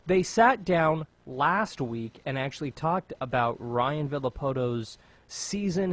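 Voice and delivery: ordinary delivery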